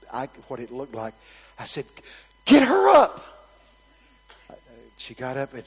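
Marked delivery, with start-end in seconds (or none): 2.4-3.2 high, loud command; shouted order. Retold or imitated. Preacher ordering help for the fallen lady, shouted. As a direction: holler for helpers to lift her. Calling out order after her fall.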